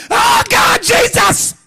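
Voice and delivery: very high, loud fake wail; mock scream. Wife faking an attack on the floor, screaming high. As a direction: shriek it like a staged fall.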